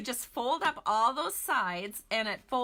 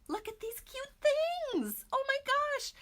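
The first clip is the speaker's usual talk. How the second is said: much higher, slow, gushing coo over cute stamps. Real, not acted. Crafter gushes to viewers over tiny stamps, high delighted coo. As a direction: squeal over how adorable these are.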